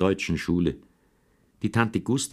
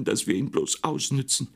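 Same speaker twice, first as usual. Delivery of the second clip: higher, tearful mock outcry; crying outburst; 8 semitones higher than usual. Sister cries out at her mother, hurt. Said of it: "schrie Marie".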